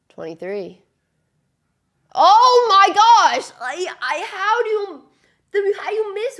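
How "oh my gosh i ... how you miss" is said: very loud, high and slow, then stammering; real outraged yell at the TV; exasperated shout. Real, not acted. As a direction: scream at the missed chance. Player misses an easy chance.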